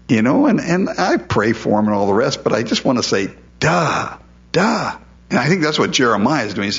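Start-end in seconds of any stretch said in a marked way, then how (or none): 3.6-5.0 sing-song duh, climbing higher; mock exasperated duh. Pastor silently answering the ruined churchgoer, mocking duh. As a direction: tease them for missing the obvious. Pastor's unspoken reply to congregant.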